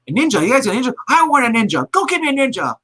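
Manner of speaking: high, whiny demanding rich brat; spoiled rich guy. Retold or imitated. Retelling a movie villain, high spoiled rich-guy whine. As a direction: demand it like a spoiled rich man who gets everything. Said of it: "typical spoiled rich guy".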